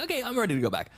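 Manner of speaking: high-pitched voice of someone who has had enough; mock change of heart. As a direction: pipe up high, playing a quick retreat. Acting out the change of mind.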